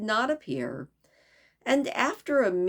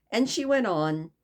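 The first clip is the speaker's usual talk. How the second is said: plain reading voice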